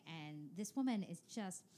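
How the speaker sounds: usual speaking voice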